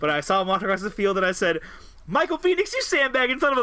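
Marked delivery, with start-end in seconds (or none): 2.1-3.6 shouting. Retold or imitated. Yelling at buddy across field.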